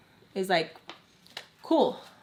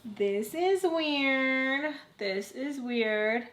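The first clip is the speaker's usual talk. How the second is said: slow, stretched-out musing; puzzled, drawn out; 3 semitones higher, 3 dB quieter, much slower than usual. Retold or imitated. Puzzling over a friend's silence, slow musing. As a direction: drag out each word as you ponder.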